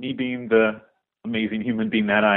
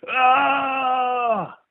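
long, very high mock yell; high sustained scream, imitating a wild man; copycat battle cry; 14 semitones higher than usual. Caller imitating the man who pulls out a knife, high mock battle cry. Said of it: "and does that same".

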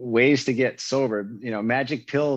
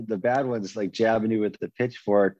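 ordinary talk

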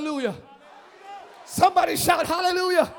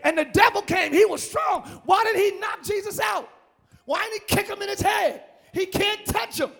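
ordinary delivery

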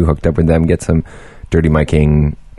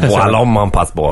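mock French accent; 4 semitones higher than usual.

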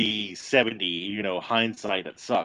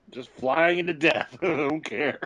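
normal talking voice

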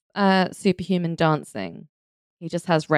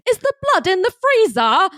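much higher, posh British imitation; upper-class British accent; 13 semitones higher than usual. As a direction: mimic a posh Brit. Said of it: "there's one bit where he says".

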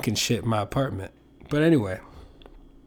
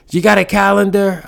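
high, loud mock grandma asking; loud, high old-lady voice teasing her grandson; grandma's voice; 7 semitones higher, 6 dB louder than usual. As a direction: imitate a nagging grandma, pitched up.

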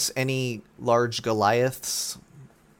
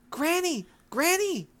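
excited call; slower than usual. Acted. Girl spotting her granny, calling out excitedly. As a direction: shout for her granny across the shore. Dashir calls to old woman.

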